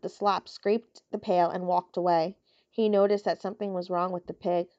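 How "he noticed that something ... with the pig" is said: usual speaking voice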